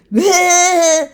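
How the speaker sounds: high mocking laugh imitating him. Woman imitating her ex laughing at her tarot cards, high mocking laugh.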